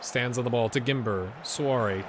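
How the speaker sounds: ordinary talk, nothing marked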